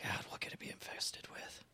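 breathy muttered whisper to himself. Real, not acted. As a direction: whisper the question under your breath. Muttering to himself mid-game.

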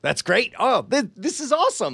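excited. Real, not acted. Thrilled by the app.

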